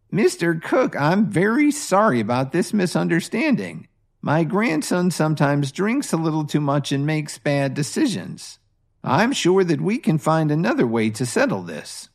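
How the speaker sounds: smooth things over with the powerful man; apologetic grandmother. Acted. Grandmother apologizes to hotel owner.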